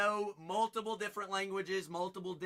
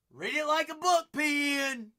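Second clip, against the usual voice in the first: calling out; 8 semitones higher, 7 dB louder than usual. Real, not acted. Host calls out to viewers.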